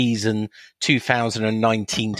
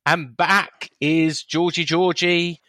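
slow, high show announcement; announcer voice; 7 semitones higher, much slower than usual. Acted.

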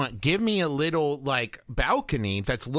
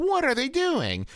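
high, mocking incredulous question; snarky host; 13 semitones higher than usual. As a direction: sneer at their choices. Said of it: "And one going like".

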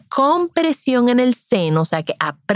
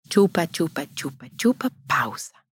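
chupa, chupa softer, pausa nearly whispered; soft sucking demo; 2 semitones lower, 5 dB quieter than usual. Shown as a demonstration. Showing mothers how real feeding sounds, soft chant. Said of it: "si tú ves".

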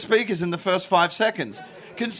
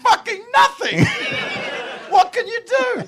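much higher, loud laughing cry; exasperated shout; 4 dB louder than usual. Real, not acted. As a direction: blurt it out through laughter.

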